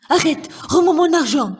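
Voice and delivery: loud, high-pitched stage yell; outraged shout. Acted. Miser to the supposed thief he grabs, shouting in outrage.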